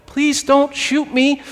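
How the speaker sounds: tiny, high, slow plea; little frightened voice. Retold or imitated. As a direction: squeak out a timid plea from hiding. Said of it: "this little voice that went".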